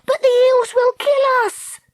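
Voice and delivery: very high, loud wail; panicked protest. A narrator's reading. Treacle pleading to give up the turtle, panicked. Terrified protest as eels attack boat.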